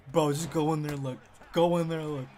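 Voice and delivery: loud, emphatic push to go look; insistent urging. Retold or imitated. Tim tells him to go in there, loud insistent urging. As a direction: urge him loudly, as a friend would.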